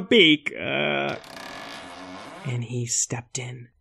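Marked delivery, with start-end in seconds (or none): 2.4-3.7 hushed dramatic whisper. A narrator's reading. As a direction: breathe it out in suspense. Suspenseful narration.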